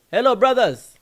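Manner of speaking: high, loud imitated greeting; calling out. African friend signaling a group over, calling out. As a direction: hail them loudly as the African friend. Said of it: "he signaled the".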